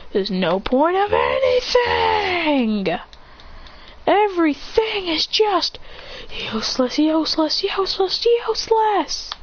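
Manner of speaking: slow rant building to a high yell on each useless; boy ranting that nothing has a point, rising to a yell; despairing rant. Acted.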